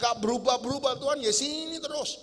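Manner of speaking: high, loud, whining lament to God; whiny complaint to God. Retold or imitated. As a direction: moan at God like a fed-up parent.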